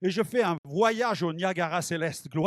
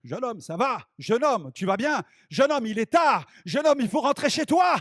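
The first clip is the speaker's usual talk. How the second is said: volume rising; 2 semitones higher than usual. Retold or imitated. Pastor bringing the young man back to earth, voice building to an urgent call. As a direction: keep calling, more insistent each time. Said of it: "Et le volume commençait à augmenter".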